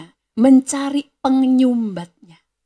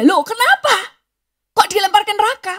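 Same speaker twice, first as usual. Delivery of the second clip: shocked protest; 10 semitones higher, 5 dB louder than usual. Acted. Woman shocked by God's warning.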